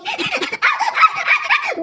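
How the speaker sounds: very high mock shriek of fright. Bully shrieking as the girls rush her. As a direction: shriek and run. Siti scared of friends.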